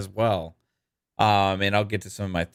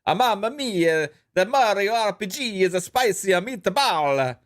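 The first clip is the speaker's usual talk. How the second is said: hammed-up Italian accent, pitched well up; sing-song mock Italian, high and excitable; 8 semitones higher than usual. Streamer obliges a viewer's super chat request, hammed-up Italian accent. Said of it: "Please, in an Italian accent".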